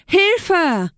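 high squealed storybook cry; high-pitched little pig's yell; cry for help. A narrator's reading. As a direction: squeal it out like a panicked piglet. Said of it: "schrie das kleine Schweinchen".

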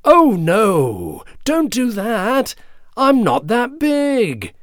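high, clear goat protest; clear-voiced goat pleading. A narrator's reading. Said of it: "in his fine and clear voice".

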